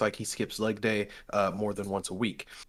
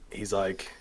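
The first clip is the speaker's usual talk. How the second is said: normal talking voice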